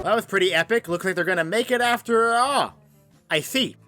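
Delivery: ordinary delivery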